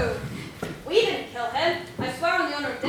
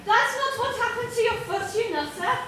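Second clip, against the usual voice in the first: a lightly put-on, hammy Irish accent, pitched higher; bad Irish accent; 9 semitones higher than usual. Pirate correcting his shipmate in a bad Irish accent. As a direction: bluster in a clumsy fake Irish accent. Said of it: "That's the worst Irish accent I've ever heard".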